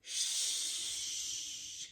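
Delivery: "Sh" whispered as a long hushing sh; sh sound. Shown as a demonstration. Teacher answers the quiz with a quiet sh. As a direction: hush the sh sound gently. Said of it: "or sh?".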